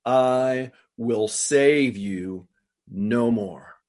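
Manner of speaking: very slow, measured verdict; restrained anger, each word stretched out; solemn anger. Acted. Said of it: "this is the anger of God".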